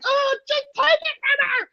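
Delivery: very high mock-terrified squeal; mock panic. Diving behind the kids from angry wife, mock panic. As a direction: squeal it like a hammed-up coward.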